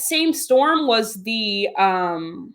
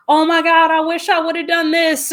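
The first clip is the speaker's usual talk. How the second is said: raised, wailed mock regret; higher, put-on panic of last regrets; mock panicked regret; 6 semitones higher, 3 dB louder than usual. Imagining people facing death, mock panicked regret. Acts out life-threatening regret.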